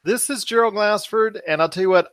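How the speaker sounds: ordinary talk, nothing marked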